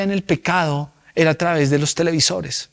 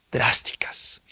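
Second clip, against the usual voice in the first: "drásticas" whispered for emphasis; dramatic whisper; 7 dB quieter, slower than usual. Acted. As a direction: breathe "drásticas" out as a hushed warning. Sermon punchline on drastic measures.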